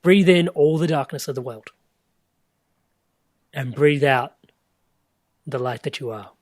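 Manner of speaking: loud, carrying stage voice, dropping off at the end; teacher on stage. Retold or imitated. Retelling Gelong's stage instruction, a loud carrying call. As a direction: project it to a whole hall of meditators. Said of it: "Gelong gets up on stage and goes".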